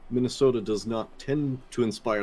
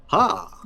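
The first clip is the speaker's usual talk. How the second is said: sharp high loud ha; bitter fake laugh; 10 semitones higher, 6 dB louder than usual. Real, not acted. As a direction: throw out one loud ha. Player reacts to failed roll.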